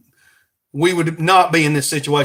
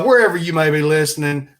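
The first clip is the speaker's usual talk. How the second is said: usual speaking voice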